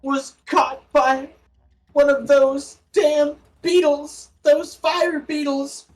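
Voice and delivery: high, slow pleading through tears; tearful. Acted. Grieving woman tells the cleric through tears how he died. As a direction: choke the words out through tears. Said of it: "say through her tears".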